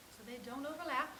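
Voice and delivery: usual speaking voice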